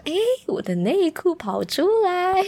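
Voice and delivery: high, slow dismayed cry; replaying her own startled reaction; mock surprise. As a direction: drag out 内 in comic dismay. Retold discovery of exposed underwear.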